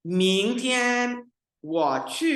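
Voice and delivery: teacher reading Mandarin sentence aloud; Mandarin model reading. Shown as a demonstration. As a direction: read it slowly for the students. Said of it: "मिलके जो है ना complete करने की कोशिश".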